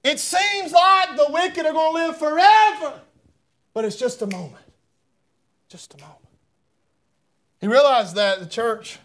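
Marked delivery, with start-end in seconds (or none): 3.7-4.7 soft aside. Acted. Drops after preaching. 5.7-6.2 breathy whispered echo; hushed repeat of the point. Acted. Whispered echo after sermon line.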